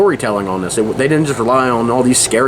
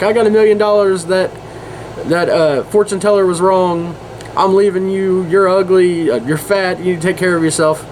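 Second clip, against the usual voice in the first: higher-pitched mock gloating; celebrating; 7 semitones higher than usual. Wife telling her husband she's rich and leaving, mock celebrating. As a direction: crow over a windfall, imitating a wife. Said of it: "she goes home celebrating".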